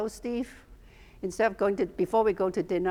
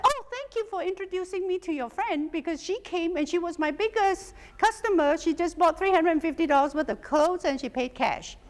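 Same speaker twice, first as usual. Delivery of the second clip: high, bright gushing friend voice; delighted friend; 8 semitones higher than usual. Retold or imitated. The friend thanks her over the phone, high and gushing. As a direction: gush like the thrilled shop owner.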